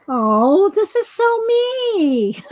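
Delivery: high, slow, thrilled "this is so me"; delighted squeal. Real, not acted. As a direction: gush over the gift with glee. Delighted by the t-shirt.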